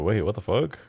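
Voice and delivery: ordinary delivery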